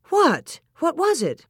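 high, sharp, urgent questions; high-pitched startled questioning; alarmed question. A narrator's reading. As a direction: demand it in sudden alarm. Wife alarmed by what he grabbed.

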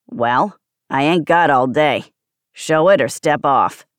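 loud, low smoker's growl; impatient, low-pitched pawnbroker rasp; two-packs-a-day growl. A narrator's reading. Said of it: "two packs a day camel's voice".

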